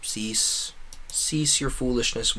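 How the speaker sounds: normal talking voice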